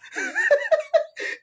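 high shrieking laugh. Real, not acted. Husband flees.

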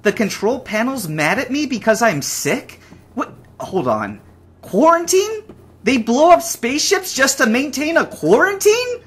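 rising shock at bad news; indignant panicked questions; alarmed disbelief. Real, not acted. Learns he's infected, quarantine.

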